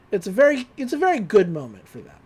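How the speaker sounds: usual speaking voice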